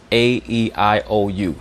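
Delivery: "A, E, I, O, U" as English letter names; English vowel names. Shown as a demonstration. Teacher recalls elementary school, English names for "A, E, I, O, U". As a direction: recite "A, E, I, O, U" as ay, ee, eye, oh, you. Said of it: "In English, we pronounce our vowels".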